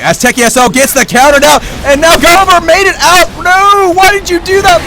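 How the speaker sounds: high, loud call rising into dismay; exasperated shoutcaster; excited play-by-play. Real, not acted. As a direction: build from live action to a pleading cry. Live play-by-play turns to dismay.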